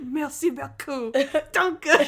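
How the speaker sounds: mock-aggressive foreign thank-yous through laughter, high. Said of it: "It's so aggressive".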